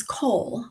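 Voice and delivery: coal, cold without its final d; slow coal ending on l; dropped last letter. Shown as a demonstration. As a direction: say cold but leave off the d, as coal. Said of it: "drop off the last letter".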